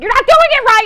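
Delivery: high, loud mock scolding yell; mom yelling. Mom scolding over the mashed potatoes, loud mock yell. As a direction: bark it like a fed-up mother. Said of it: "your mom's yelling at you".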